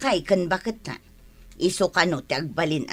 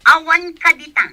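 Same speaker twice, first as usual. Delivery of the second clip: loud furious scolding, pitch raised; angry shout; 9 semitones higher, 9 dB louder than usual. Acted. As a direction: bark the order, loud and high. Boss shouts at the maid.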